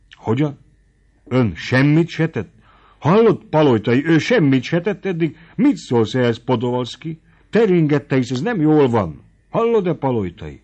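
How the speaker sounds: magasabban, hangosan hitetlenkedve; felháborodott csodálkozás. A narrator's reading. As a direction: nem hiszi el, amit hall. (a gróf Tóth tétlenségén hüledezik, hangosan)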